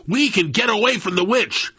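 high-pitched urgent shout. A narrator's reading. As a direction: shout it quickly to rally a frightened friend. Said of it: "and shouted".

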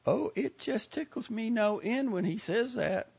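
put-on high, gushing old lady voice; a proud, fussy Southern lady; chatty old lady. A narrator's reading. Lady character voice.

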